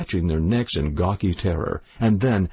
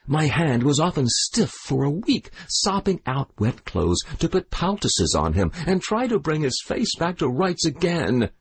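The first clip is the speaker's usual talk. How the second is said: haranguing; 6 semitones higher than usual. A narrator's reading. The widow rails at the crowd about her son. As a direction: rail to the crowd.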